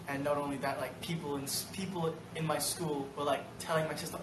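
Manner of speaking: ordinary talk, nothing marked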